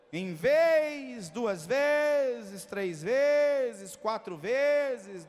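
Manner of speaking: loud declaration with long-drawn words; preaching. Real, not acted. Preacher promising the congregation visitations, loud stretched preaching. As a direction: proclaim it, stretching the key words.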